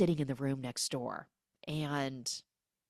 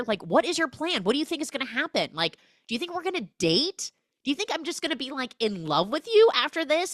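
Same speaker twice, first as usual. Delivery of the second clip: mock rant; 9 semitones higher, 4 dB louder, faster than usual. Ranting at Ryan.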